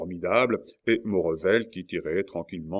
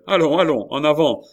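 high, loud urging; rallying call; 8 semitones higher, 10 dB louder than usual. A narrator's reading. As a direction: drive the others forward. Coconas urges the attack. (exhortation forte et aiguë)

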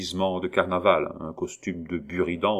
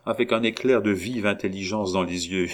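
plain reading voice

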